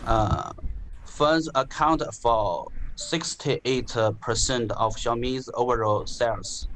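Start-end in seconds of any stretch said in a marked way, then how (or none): none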